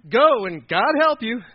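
loud, high, drawn-out send-off; slow, exaggerated good-luck-with-that; doubtful king. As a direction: play a king waving off a doomed kid. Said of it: "Saul was like".

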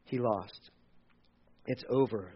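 soft, low defeated words; hopeless. Retold or imitated. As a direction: let the hope drain out. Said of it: "the disciples thought".

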